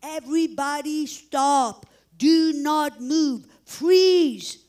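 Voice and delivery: drawn-out, loud emergency order; imitating an urgent shouted freeze order; retold screamed command. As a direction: call out each command slowly and forcefully. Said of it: "we hear a big scream".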